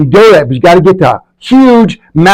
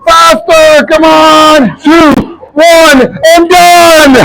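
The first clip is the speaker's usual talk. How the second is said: shouting encouragement; 11 semitones higher, slower than usual. Real, not acted. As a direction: drive them hard to the finish. Calling out participants by name.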